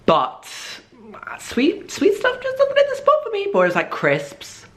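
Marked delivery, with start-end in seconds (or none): 1.4-3.5 very high squeaky falsetto. Pretend. Vlogger complaining about sweet food, squeaky falsetto. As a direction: squeak it out in a tiny voice.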